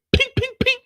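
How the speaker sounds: very high, sharp ping sounds; high pinging gunfire noises; silenced gunshots. Retold or imitated. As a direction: mimic a silencer firing. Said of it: "That's the sign of the silencer".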